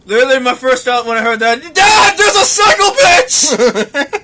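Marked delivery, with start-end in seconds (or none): 1.7-4.2 high, loud, drawn-out cry for Dad; hammed-up yell of alarm; panicked shout. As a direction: holler it in comic panic. Acts out his first panicked thought.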